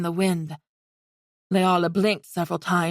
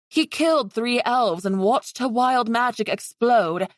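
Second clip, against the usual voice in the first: plain reading voice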